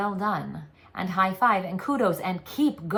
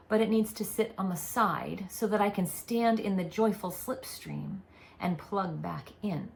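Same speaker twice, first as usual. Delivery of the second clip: usual speaking voice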